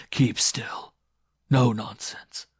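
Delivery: loud, hard order; forceful command. Acted. Man subdues the struggling woman, loud command. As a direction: raise the voice to enforce obedience.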